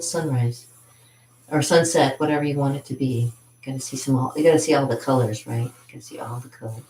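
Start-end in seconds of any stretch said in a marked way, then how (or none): 5.6-6.8 soft repeat under the breath; quiet echo. Shown as a demonstration. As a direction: say it again, much softer.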